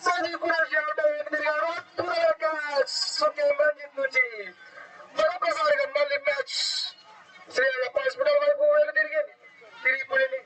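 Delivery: high, shouting wicket call; excited cricket commentary. Real, not acted. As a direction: erupt as the catch is taken. Wicket falls, commentator erupts.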